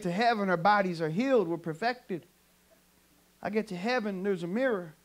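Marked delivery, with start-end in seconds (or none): none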